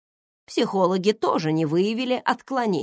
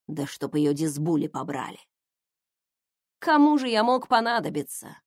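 plain reading voice

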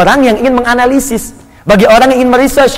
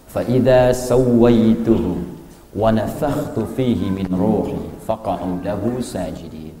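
slow, soft, low recitation; Quran recitation; 11 semitones lower, 9 dB quieter, much slower than usual. Real, not acted. Preacher continuing the verse for the congregation, hushed slow recitation. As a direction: recite the verse slowly and quietly.

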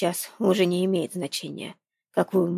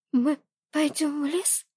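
teeth chattering; 9 semitones higher than usual. Acted. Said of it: "тихо спросила она, стуча зубами".

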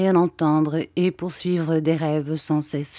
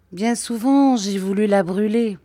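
moaning lament; 4 semitones higher, 3 dB louder than usual. A narrator's reading. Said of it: "gémit-il".

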